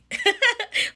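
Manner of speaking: sudden high laugh; high, amused laugh; high burst of laughter. Real, not acted. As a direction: crack up at her own joke. Laughs at own joke.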